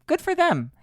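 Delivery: sing-song high good for them; mock cheer. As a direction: repeat it in a high mocking lilt. Mocking repeat of her praise.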